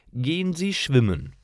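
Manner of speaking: ordinary talk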